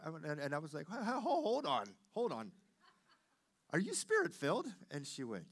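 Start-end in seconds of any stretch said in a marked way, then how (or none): 0.9-2.5 loud, high, stammered halt with a laugh; startled interruption. Acted. Interrupting own prayer on hearing tongues. 3.7-4.7 loud, high, excited asking; astonished question. Acted. Astonished question to praying neighbor.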